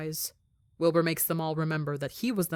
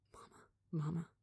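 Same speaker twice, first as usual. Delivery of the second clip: much softer, breathy sobbed words; boy sobbing into his mother's arms; sobbing whisper; 12 dB quieter than usual. Acted. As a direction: cry it into her shirt. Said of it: "as he sobs".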